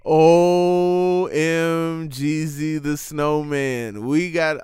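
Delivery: slow, loud drawn-out excitement; excited. Real, not acted. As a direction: stretch it out with glee. Excited reaction.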